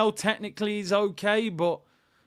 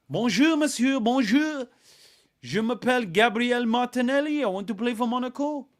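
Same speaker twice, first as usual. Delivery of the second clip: higher-pitched mock French accent; 6 semitones higher than usual. Martinelli mockingly introduced to Monaco, French accent. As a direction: play the footballer with a comic French accent. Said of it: "You love the French accent".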